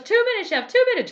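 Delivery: very high, loud frantic squeal; yelling in panic. Retold or imitated. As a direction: shriek the time in a hammed-up panic. Elsie panicking, yelling numbers.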